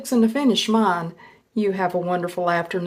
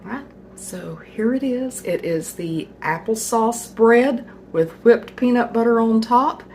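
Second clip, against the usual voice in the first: ordinary delivery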